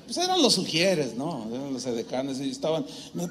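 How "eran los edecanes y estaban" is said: ordinary delivery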